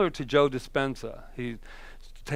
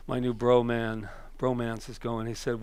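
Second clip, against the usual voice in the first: normal talking voice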